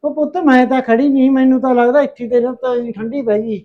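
loud, sharp telling-off of the daughter; angry scolding. Acted. Mother scolding her daughter over weak tea, loud and angry. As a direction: snap back at her, loud and cross.

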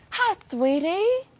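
high, soft, slow coo. Real, not acted. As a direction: coo to the shy alpaca. Cooing to alpaca.

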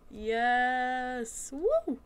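loud drawn-out yes into a high woo; elated, rising whoop; victory cheer. Real, not acted. Celebrating a win.

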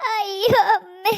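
slow crying wail; child's slow crying wail. Acted. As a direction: sob out the words while being hit. Child beaten with stick.

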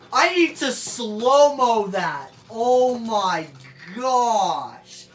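loud, high and slow in amazement; amazed exclamation. Real, not acted. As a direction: stretch the words out, blown away. Amazed by the battle result.

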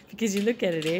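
put-on playful Canadian accent with eh. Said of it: "That was for our Canadian friends".

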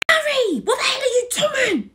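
alarmed shout. Acted. Alarmed calling out.

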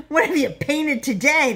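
high-pitched mocking question; derisive snort. A narrator's reading. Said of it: "they snorted".

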